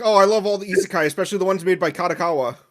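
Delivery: high, loud, rushed fanboy gush; YouTuber parody. Acted. Co-host quoting an old parody bit, high excited YouTuber voice. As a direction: put on a gushing, fast-talking anime fan. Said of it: "that's the one where he's like".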